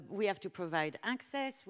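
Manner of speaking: usual speaking voice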